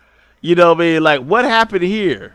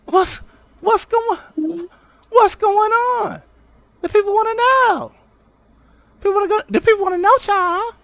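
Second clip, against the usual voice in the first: much higher, squeaky mock outrage; mock high-pitched demand. Streamer mocking Tracy in a high voice. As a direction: squeal it like a nosy gossip.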